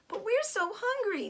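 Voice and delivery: cried. A narrator's reading. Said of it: "they cried".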